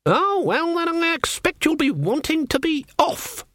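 put-on very high-pitched, knife-edged old witch; false politeness with a sharp edge; cutting. A narrator's reading. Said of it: "her voice like knives".

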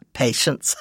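breathy chuckle on patience; laughing self-correction. Real, not acted. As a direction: laugh it off and fix the word patience.

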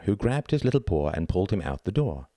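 plain reading voice

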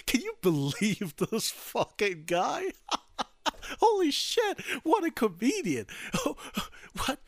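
high-pitched mocking guffaws; busting out laughing. Secret Service dudes ridiculing the new president, uproarious laughter. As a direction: crack up while jeering. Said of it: "they just start busting out laughing".

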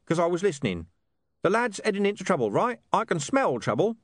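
high-pitched, colloquial talking-dog voice; Gaspode, streetwise and brusque. A narrator's reading. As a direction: rattle it off as a cocky street mutt.

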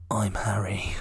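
breathy seductive whisper; hot whisper. Acted. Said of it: "he hotly whispered".

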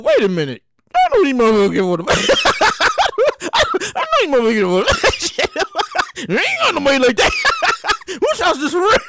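giggling through a puzzled thought; cracking up while wondering aloud; laughing inner thought. Retold or imitated. Wedding guest laughing to himself about the hosts.

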